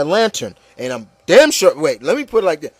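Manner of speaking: usual speaking voice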